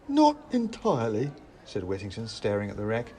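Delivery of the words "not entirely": high, loud hedging reply; higher-pitched, awkward answer; sheepish admission. A narrator's reading. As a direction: admit it in a lifted voice. Whittington's rueful answer, high-pitched.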